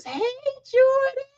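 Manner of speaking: high, slow, delighted cry of Jordan; real joy at seeing Jordan; excited greeting. Real, not acted. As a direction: call out to a favorite viewer. Greeting a viewer in live chat.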